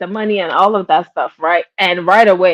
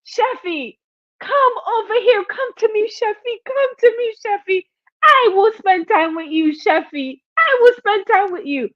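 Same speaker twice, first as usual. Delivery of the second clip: Jay Wilson beckoning; 11 semitones higher than usual. Retold or imitated. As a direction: lure her in with syrupy calls. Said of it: "here comes Jay Wilson".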